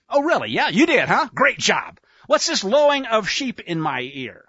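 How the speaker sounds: lively mock-impressed sarcasm; sarcastic. Samuel mocking Saul's great job, sarcastically. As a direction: pretend to congratulate, laying on the sarcasm. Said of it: "God love the sarcasm".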